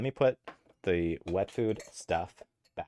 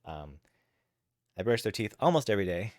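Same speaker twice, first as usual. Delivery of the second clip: ordinary talk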